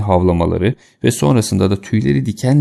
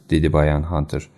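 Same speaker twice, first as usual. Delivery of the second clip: ordinary delivery, nothing marked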